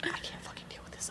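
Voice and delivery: whispered in disbelief; a stunned whisper with a laugh breaking through; shaken whisper. Retold or imitated. Whispering to herself after the stalker walks out. Stalker just left the shop.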